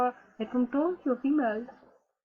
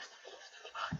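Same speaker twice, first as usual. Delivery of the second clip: whispered under breath; 19 dB quieter than usual. Acted. Shocked by an atheist status, whispered under breath. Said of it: "Can't believe I said that out loud".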